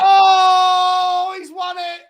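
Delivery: long, high "oh", then fading; anguished cry. Real, not acted. Fan watching the late loser, anguished cry. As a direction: wail it as the goal goes in.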